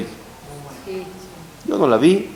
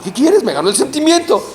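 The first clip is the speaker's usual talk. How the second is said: defensive reply; 10 semitones higher, 3 dB louder than usual. Retold or imitated.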